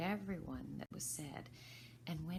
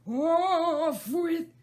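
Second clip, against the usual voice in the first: even higher, shrieking rage; furious screaming; 12 semitones higher, 16 dB louder than usual. Acted. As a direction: screech it, cut off mid-order. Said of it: "began screaming".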